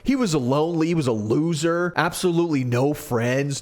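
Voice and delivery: usual speaking voice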